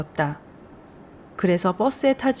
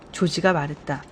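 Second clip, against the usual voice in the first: plain reading voice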